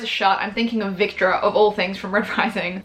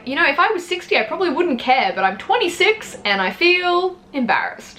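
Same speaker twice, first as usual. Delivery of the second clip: higher, sheepish protest, slowing at the end; embarrassed protest; 8 semitones higher than usual. Retold or imitated. Young patient protesting to nurses about the commode, sheepish and higher. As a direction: sound mortified but half joking.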